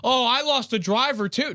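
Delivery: high sarcastic frat bro voice; mock him like frat boys. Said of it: "They go".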